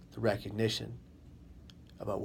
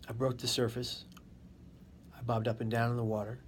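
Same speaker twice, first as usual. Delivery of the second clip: normal talking voice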